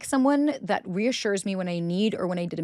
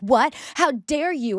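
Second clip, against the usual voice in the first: mock outrage; 6 semitones higher, slower than usual. Baiting a partner, then faking outrage at the answer. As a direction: act shocked at the compliment. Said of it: "you're like".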